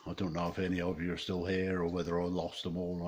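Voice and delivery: ordinary talk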